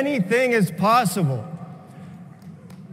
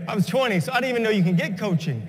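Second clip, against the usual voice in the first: ordinary talk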